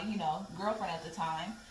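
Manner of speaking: ordinary talk, nothing marked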